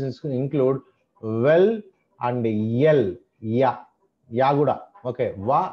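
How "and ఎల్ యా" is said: ordinary talk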